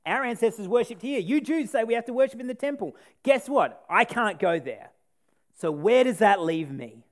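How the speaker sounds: bitter anger. Retold or imitated. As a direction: press her grievance bitterly. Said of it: "This woman is angry".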